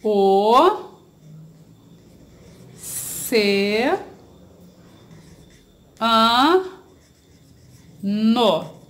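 OCEANO split into O, CE, A, NO with long pauses; syllable by syllable. Shown as a demonstration. Teacher separating a word for pupils, syllable by syllable. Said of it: "separar as sílabas dessa palavra".

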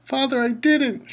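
very high, slow, frightened shriek; shrieking plea. A narrator's reading. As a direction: shriek at your father in terror. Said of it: "shrieked the boy in chains below".

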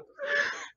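high squealing laugh; real high-pitched cackle; shrieking laugh. Real, not acted. Reacting to the fanfic.

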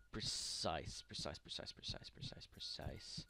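soft, unvoiced repeated whisper. Real, not acted. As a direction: whisper it to steady yourself. Careful tool use, whispered.

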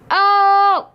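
loud, high, held "oh"; drawn-out loud exclamation; exclaimed oh. Real, not acted. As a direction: let out a big high "oh". Exclaims at game.

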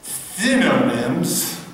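synonyms drawn out slowly, syllable by syllable. Shown as a demonstration. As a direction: spell synonyms out in slow motion. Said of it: "I have to say this slowly".

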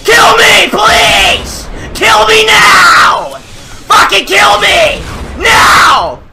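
anguished crying shout; begging in despairing rage; raging gamer. Real, not acted. Streamer raging at game.

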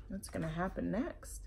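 hushed suspense whisper. Real, not acted. Teacher whispering suspense to the listening children. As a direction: whisper the question. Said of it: "whispered aside to children".

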